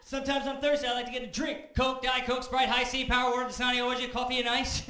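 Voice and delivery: building from list to loud, high rant; getting angry. Acted. Comic reciting the whole menu, growing loud and furious. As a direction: reel off the menu, rising into a yell. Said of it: "Just getting angry".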